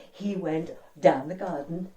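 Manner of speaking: ordinary delivery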